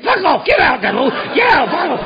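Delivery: loud, high snarl; angry yell. Retold or imitated. Addict yelling at worried friends to get away, angry and loud. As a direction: shout them off like a man possessed.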